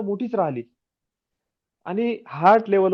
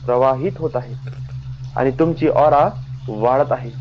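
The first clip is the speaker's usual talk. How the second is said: guided meditation; 3 semitones lower than usual. Acted.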